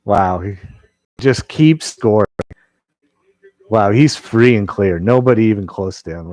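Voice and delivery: loud thrilled commentary; excited call. Real, not acted. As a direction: cheer a breakaway. Another scoring chance watched live.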